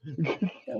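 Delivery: soft laugh. Real, not acted. Laughing at own line.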